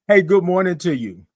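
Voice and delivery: ordinary talk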